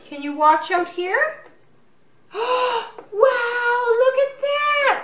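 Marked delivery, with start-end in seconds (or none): 2.3-5.0 high gasping wow; excited gasp. Real, not acted. Mother gasps in delight for her toddler.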